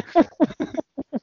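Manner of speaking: high-pitched cackle; high laugh. Real, not acted. Laugh after own joke.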